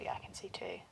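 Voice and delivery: breathy whisper, no voice. Real, not acted. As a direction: whisper it to yourself. Counting clues, drops to whisper.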